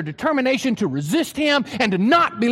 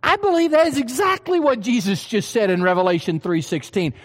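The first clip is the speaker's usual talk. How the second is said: ordinary talk, nothing marked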